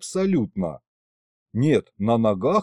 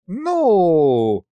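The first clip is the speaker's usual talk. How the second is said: high, long drawn-out haggling opener; sly; 5 semitones higher, 5 dB louder than usual. A narrator's reading. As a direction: stretch the word craftily, falling slowly. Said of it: "хитро прищурился Мировид". (хитро, протяжно)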